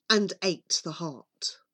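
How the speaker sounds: ordinary delivery, nothing marked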